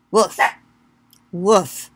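a soft, quiet woof; a calm little woof to show the dog. Shown as a demonstration. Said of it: "You're supposed to go woof".

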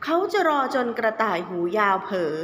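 plain reading voice

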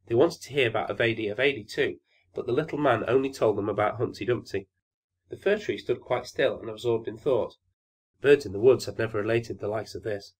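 ordinary delivery

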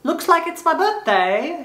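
mock a cheeky English bloke; English accent. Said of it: "pretend I'm from England and be like".

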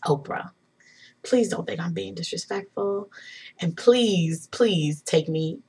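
soft, careful, respectful request; hushed, humble appeal; hesitant pleading. Retold or imitated. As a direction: tread gently, spacing out the plea. Retold timid, respectful request to Oprah.

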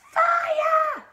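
very high, long scream of alarm; yell. A narrator's reading. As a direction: raise the alarm at full pitch. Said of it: "the old woman yelled".

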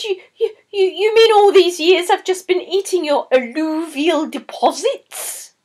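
husband gasping at what he's been eating; stunned disbelief. A narrator's reading. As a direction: stammer in horrified disbelief. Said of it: "He said".

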